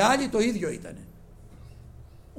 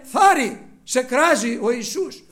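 loud, high encouraging call; 8 semitones higher, 11 dB louder, slower than usual. Retold or imitated. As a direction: call to him across the crowd. People calling the blind man.

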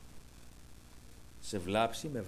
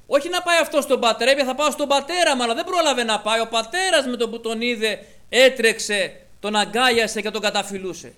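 higher and louder, building with fervour; fervent preaching; 8 semitones higher, 9 dB louder than usual. Real, not acted. Preacher fervently proclaims God's love to the congregation. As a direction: proclaim it to the whole church.

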